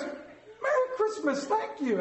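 high, slow Merry Christmas; a lilting falsetto of the server; mock sweet drive-thru server. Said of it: "She goes".

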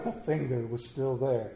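ordinary delivery, nothing marked

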